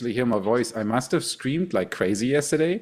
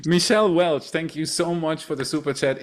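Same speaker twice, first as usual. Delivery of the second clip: usual speaking voice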